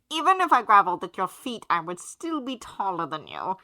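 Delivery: loud, pompous, theatrical aristocrat; haughty gentleman voice. A narrator's reading. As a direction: sneer it grandly like a snob.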